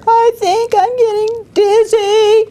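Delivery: high, whimpering hurt bird; high, whiny sob of a hurt little bird; crying. Acted. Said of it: "Bird cried louder".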